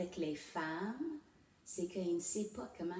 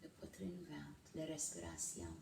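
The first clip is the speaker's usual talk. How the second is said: guided meditation; 7 dB quieter than usual. Real, not acted. Host leading viewers through breathing and a mantra, guided meditation. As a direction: ease them into calm, leaving long pauses, softening as you go.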